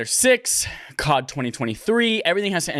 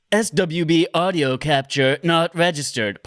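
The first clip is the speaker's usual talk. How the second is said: software pop-up notice; 3 semitones higher, 3 dB louder, slower than usual. Retold or imitated. Reads out pop-up software warning.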